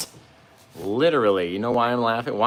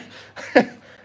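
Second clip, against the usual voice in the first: loud breathy giggle fit; can't hold the laugh in; burst of laughter; 9 dB louder than usual. Real, not acted. Painter laughing at her own joke on stream, helpless giggle. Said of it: "I just cracked myself up".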